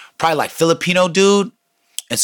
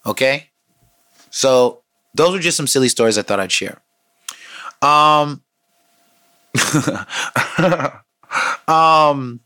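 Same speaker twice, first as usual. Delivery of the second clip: usual speaking voice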